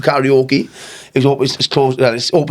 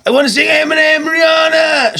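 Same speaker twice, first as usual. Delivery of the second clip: high mock drunken shout; imitated shouting; 14 semitones higher than usual. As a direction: holler it like a wasted punter demanding a song.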